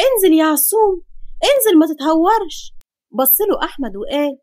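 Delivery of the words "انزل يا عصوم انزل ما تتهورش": higher, urgent call, a mild scream; raised voice in acted alarm; alarmed cry. A narrator's reading. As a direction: cry out to stop someone. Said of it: "فاطمة صرخت وقالت".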